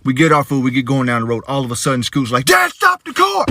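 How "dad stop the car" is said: put-on high, slow urgent little-girl cry; child yelling. Daughter yelling at dad to stop the car. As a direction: shout it like a kid in a panic. Daughter yells from back seat.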